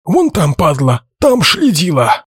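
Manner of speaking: lisping lookout shouting, high and slow; slushy sh for s. A narrator's reading. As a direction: shout it with a slushy lisp, "следила" with sh. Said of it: "А часовой-то шепелявит".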